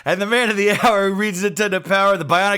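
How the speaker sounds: loud hype host intro; announcer intro. Acted. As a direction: boom it out like a show announcer.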